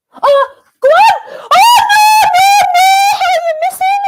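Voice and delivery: high frantic screams at a missed chance; yelling at the screen as the shot goes wide; screaming. Real, not acted. Fan screaming at the TV over a missed chance, anguished. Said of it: "I'm so sorry for screaming".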